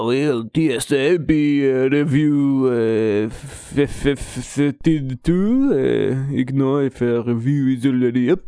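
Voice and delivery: put-on thick, slow French accent reading. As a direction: read it in a heavy French accent. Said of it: "that accent was too thick".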